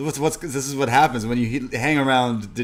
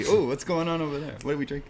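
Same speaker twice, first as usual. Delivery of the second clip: raised, nosy ooh, easing down by the end; mock party curiosity; 4 semitones higher, faster than usual. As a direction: play the keen guest butting in. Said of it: "That's me and you at a party".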